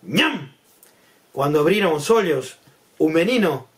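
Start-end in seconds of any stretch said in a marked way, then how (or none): 0.0-0.5 swooping cartoon gulp; sudden "ñam" with pitch leaping up and down; chomp noise. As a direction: imitate a crocodile gobbling someone up. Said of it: "ouviron un".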